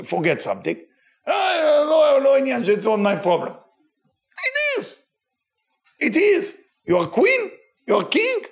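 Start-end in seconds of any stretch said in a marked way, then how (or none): 1.3-3.5 high and loud, waving it off; mock dismissive roommate. College roommate refusing to clean up, mock dismissive. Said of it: "I said, sometimes when the girls do". 4.5-8.4 high, rising, pressing the point home; emphatic insisting. Real, not acted. Rabbi telling the students they are royalty, emphatic. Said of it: "rebuking the roommate".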